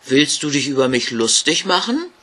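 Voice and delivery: as if she were mocking him; stern question. Ghost suspects the girl mocks him, stern. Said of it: "schaute streng an und fragte".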